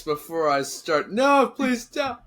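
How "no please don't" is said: mock pleading. Mock pleading over snacks.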